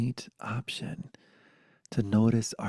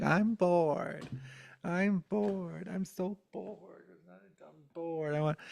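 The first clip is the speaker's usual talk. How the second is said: put-on high-pitched, fading childish whine; whining bored kid; 7 semitones higher, 4 dB quieter, slower than usual. Bored kid whining at home, high and fading.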